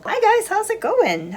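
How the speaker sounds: bright high hello; high cheery greeting. Real, not acted. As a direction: greet them with a lifted voice.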